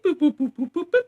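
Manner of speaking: high sing-song beep boop; mock computer beeps. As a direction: chirp it like a scanning machine. Imitating facial recognition computer.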